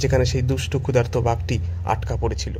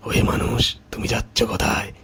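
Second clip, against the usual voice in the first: menacing tiger; 2 semitones lower than usual. Acted. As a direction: threaten your prey as a hungry tiger. Freed tiger threatens the farmer.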